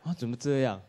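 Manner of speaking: ordinary talk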